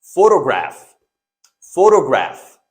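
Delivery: "Photograph" loud, stressed on the first syllable; modelled pronunciation. Shown as a demonstration. Teacher drilling the word for students, "Photograph" loud and deliberate. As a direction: say "Photograph" loudly as FOH-toh-graf, falling. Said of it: "This is graph".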